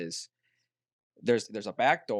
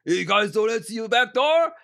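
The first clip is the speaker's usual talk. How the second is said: higher, louder New York trucker complaint; New York accent; 10 semitones higher, 9 dB louder than usual. Retold or imitated. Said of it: "a New York accent".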